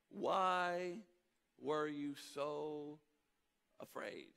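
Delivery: Jesus gently asking the disciples; gentle question. Retold or imitated. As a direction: ask it tenderly, drawing it out. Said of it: "Not what were you afraid of?".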